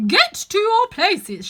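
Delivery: slow, booming, high royal shout; voice of thunder. Acted. The Queen ordering everyone to places, thunderous and slow. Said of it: "in a voice of thunder".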